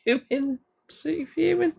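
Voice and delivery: half-laughing mock fury; laughing at own anger. Real, not acted. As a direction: laugh it off while saying fuming. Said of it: "I'm fuming".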